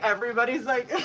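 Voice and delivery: ordinary talk, nothing marked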